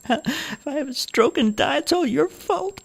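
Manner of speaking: put-on high old woman faking a whimper, no real tears; guilt-trip her daughter with a put-on sob; mock crying old mother. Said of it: "Ruth pretended to cry".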